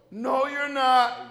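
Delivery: loud, drawn-out group protest; slow, high, booming contradiction; mock chorus objection. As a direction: stretch it out like everyone objecting at once. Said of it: "all of them in harmony goes".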